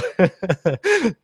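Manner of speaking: high giggle; real laugh. Real, not acted. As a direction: giggle freely. Laughing at own joke.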